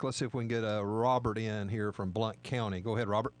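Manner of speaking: ordinary talk, nothing marked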